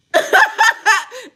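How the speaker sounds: sudden high burst of laughter; real burst of laughter. Real, not acted. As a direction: cackle high and loud. Cracks up.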